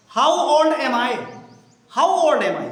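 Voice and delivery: spaced out word by word; teacher reading the new question slowly to the class; slow model reading. Shown as a demonstration. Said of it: "teacher models the question slowly".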